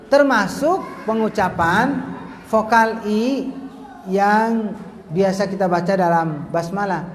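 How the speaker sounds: ordinary talk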